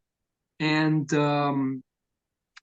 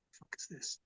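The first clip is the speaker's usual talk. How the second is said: hushed, breathy disbelief; shocked whisper; 24 dB quieter than usual. Retold or imitated. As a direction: whisper it like you're scared to be heard. Dark abandoned house, retold reaction.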